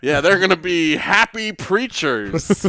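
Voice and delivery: loud, high, drawn-out boss impression; Vince McMahon. Wrestling boss announcing happy preachers, loud and slow. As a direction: stretch it out with mock bluster. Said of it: "Vince McMahon was like".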